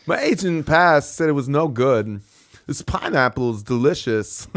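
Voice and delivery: halting actor impression, starting high and loud; Christopher Walken. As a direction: land odd stresses like a quirky movie star. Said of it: "That's good".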